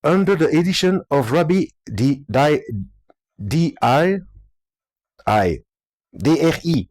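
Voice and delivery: slow, halting English with a French accent. Real, not acted. Reading the translator's English aloud, laboured French accent. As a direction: struggle through English word by word, French-accented. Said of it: "Mon accent anglais".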